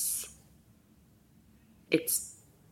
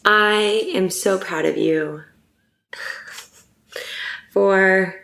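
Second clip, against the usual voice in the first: slow, choked-up words breaking into a teary laugh; tearful laugh; 2 semitones higher, slower than usual. Real, not acted. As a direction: get through it while tears come. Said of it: "I'm not crying".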